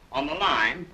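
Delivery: usual speaking voice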